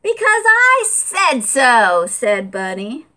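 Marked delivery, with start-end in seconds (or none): 0.0-2.0 high, slow bossy reply. Acted. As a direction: lay down the law up high. Bunny answers bossily.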